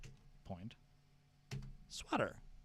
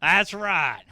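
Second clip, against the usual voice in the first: mock fast food server; 4 semitones higher, 7 dB louder, slower than usual.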